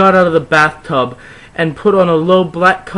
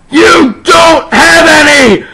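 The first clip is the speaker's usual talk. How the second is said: high loud slow hammed-up crying yell; overacted mad yell; yelled madly; 13 semitones higher, 8 dB louder, slower than usual. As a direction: shriek it in mock fury. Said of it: "I yelled in madly".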